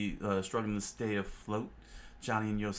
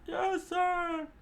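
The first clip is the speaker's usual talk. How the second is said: much higher, shouted reply; high squeaky cook. Acted. Cooks answering their chef, high and shouted. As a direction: snap back like an eager cook.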